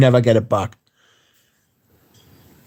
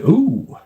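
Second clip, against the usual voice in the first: wincing ooh; 6 semitones higher than usual. Retold or imitated. Wincing at a retold injury.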